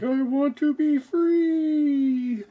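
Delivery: high, slow wailing cat voice; high slow mock-cat plea; 1 voicing the cat. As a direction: stretch it out like a yowling cat. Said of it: "Bud's like".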